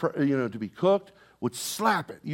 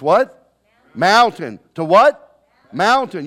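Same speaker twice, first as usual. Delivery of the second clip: call and response; 3 semitones higher, 11 dB louder, slower than usual. Real, not acted.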